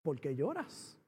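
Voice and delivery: very low, soft question; imitated gentle voice from heaven; sublime voice of God. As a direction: ask with hushed, calm authority. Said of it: "esa voz sublime que me dijo".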